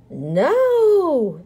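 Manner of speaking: long, loud, high crowd yell. A narrator's reading. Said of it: "the crowd yelled".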